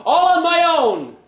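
very high, loud triumphant shout; proud crow. Acted. Larry boasting to himself on stage, loud and high. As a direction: shout it to the whole room. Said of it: "he felt like saying".

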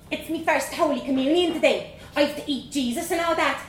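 put-on high, bold Dublin girl voice; young Dublin girl. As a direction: play a cheeky little Dublin girl. Child Vanessa voiced in story.

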